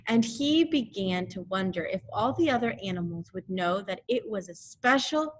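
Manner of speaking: plain reading voice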